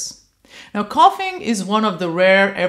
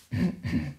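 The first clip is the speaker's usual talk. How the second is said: low soft rattling cough; a small polite cough with a rattle; light rattly cough; 7 dB quieter than usual. Shown as a demonstration. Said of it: "you can really hear that rattle going on".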